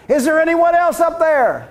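desperate plea. Acted. Man rejects God's answer, punchline.

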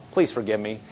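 soft, low voice, asking forgiveness; gentle apology. Acted. Husband asking his wife's forgiveness, soft low apology.

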